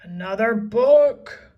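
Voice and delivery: loud, breathy outraged roar. A narrator's reading. Captain finding another book, loud outraged roar. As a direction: roar in disgust. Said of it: "roared Cap'n Bones".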